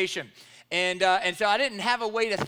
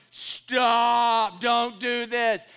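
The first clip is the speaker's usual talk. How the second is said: mock inner plea; 3 semitones higher, 7 dB louder, much slower than usual. Inner plea during scene.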